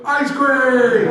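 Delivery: high, loud, drawn-out group yell; hollered greeting. Retold or imitated. Dudes on the phone answering the group text, hollering. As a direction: holler it like a bunch of rowdy dudes.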